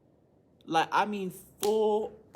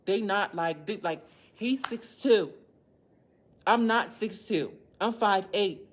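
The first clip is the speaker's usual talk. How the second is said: outraged; 4 semitones higher, 7 dB louder, slower than usual. Real, not acted. Indignant about the big kid.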